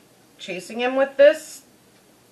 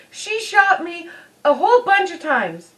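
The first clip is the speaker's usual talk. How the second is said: whiny son; 7 semitones higher than usual. Retold or imitated. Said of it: "is like".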